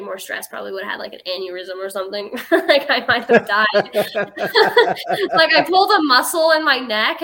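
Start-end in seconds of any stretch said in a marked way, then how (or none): 2.5-7.2 real laughter breaking through, higher and louder; laughing. Real, not acted. Telling the host about her stress, laughing hard. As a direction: laugh out the words in disbelief.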